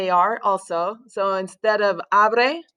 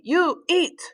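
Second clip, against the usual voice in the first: YOU set apart and pitched high, then EAT as an order; command intonation; 6 semitones higher than usual. Shown as a demonstration. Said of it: "command them to eat".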